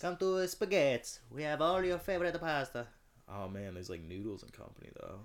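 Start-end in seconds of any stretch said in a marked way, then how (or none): 0.0-2.9 high, loud cartoon pitchman with put-on accent; mock restaurant ad. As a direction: hawk pasta in a silly voice.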